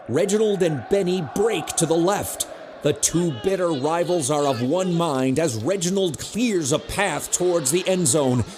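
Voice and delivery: ordinary talk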